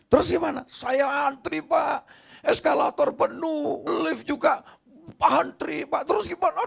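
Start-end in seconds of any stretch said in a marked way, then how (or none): none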